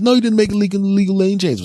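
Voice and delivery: high and fast, teasing; mock friend's voice. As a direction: chirp it back like a carefree friend. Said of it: "I said".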